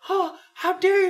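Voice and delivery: very high, scandalized mock parent; hammed-up high-pitched indignation; imitating offended parent. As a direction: gasp it like an affronted parent. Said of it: "the parents look at you like".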